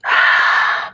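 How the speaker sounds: long hard breath out on exertion; forceful exhale. Real, not acted. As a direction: blow the air out sharply with effort. Exhaling through exercise reps.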